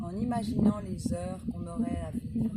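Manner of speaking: measured Proust reading, pausing between phrases; poised recital with spaced-out words; literary reading aloud. A narrator's reading. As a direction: read it out phrase by phrase, savouring each. Reads a Proust extract aloud.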